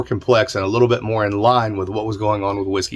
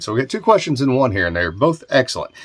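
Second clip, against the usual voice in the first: usual speaking voice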